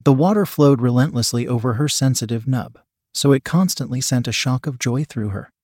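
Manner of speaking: plain reading voice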